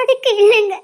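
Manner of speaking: loud tearful denial; choked-up, weepy reply; crying. Acted. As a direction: protest through sobs. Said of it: "எதுக்குடி அழுற".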